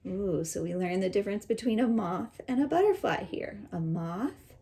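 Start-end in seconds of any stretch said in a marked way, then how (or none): none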